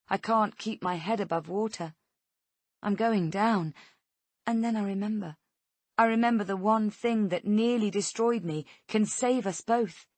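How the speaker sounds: usual speaking voice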